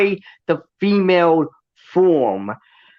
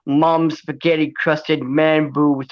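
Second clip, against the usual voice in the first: normal talking voice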